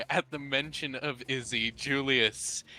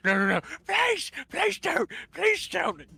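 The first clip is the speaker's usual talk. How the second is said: terrified pleading. Acted. Clive begging Julius not to kill him, terrified. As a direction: beg for your life.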